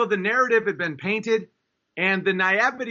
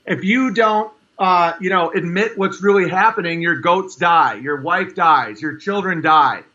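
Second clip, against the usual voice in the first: rising urgent warning; 6 dB louder than usual. Real, not acted. Building warning about lies.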